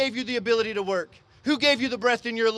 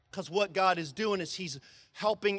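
ordinary delivery

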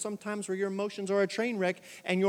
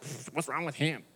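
scoffing churchgoer; 4 semitones lower, 4 dB quieter than usual. Retold or imitated. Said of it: "everybody's like".

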